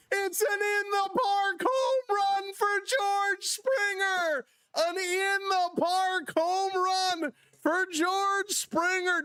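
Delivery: slow, high, stretched-out call; drawn-out home run call. Real, not acted. Announcer savoring the in-the-park homer, slow and high. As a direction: stretch every word in triumph.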